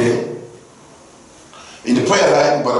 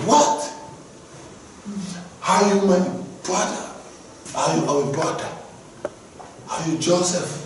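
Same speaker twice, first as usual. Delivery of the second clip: higher, incredulous questions, fading softer; stunned disbelief; 5 semitones higher, 6 dB quieter than usual. Acted. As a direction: gasp it out in disbelief. Brothers stunned by Joseph's reveal.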